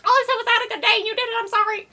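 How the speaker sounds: high, loud, fast exasperated outburst; exasperated mock protest. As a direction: blurt it out high and fast in disbelief. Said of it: "And you're like".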